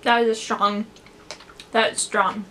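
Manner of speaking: talking between chews; chewing while talking. Real, not acted. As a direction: mouth partly full of candy. Seen 2: Put snack in mouth at -9; lips pressed, jaw working while speaking.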